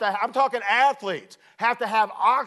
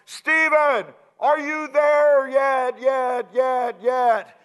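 shouted call, higher and slow; 5 semitones higher, 4 dB louder, much slower than usual. Retold or imitated. Reno calling to Stephen ahead in a blizzard, shouting. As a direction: yell up the mountain through a blizzard.

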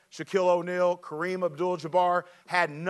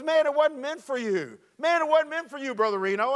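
put-on high, sheepish kid's voice; teen boy voice; 7 semitones higher than usual. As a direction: play the guilty teenager dodging the question. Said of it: "He goes".

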